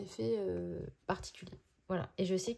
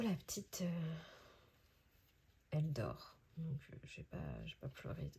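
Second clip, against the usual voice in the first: whispering over a crib; 3 semitones lower, 6 dB quieter than usual. Real, not acted. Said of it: "je vais pas parler trop fort".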